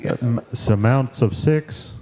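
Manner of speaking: earnest cartoon dad ordering food; deadpan cartoon dad at the drive-thru; Hank Hill. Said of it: "I do it at drive-thrus all the time".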